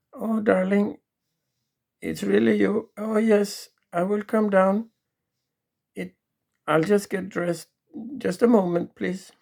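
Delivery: devoted wife calling down; sweet wife. Acted. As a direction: answer him lovingly. Wife answers from upstairs.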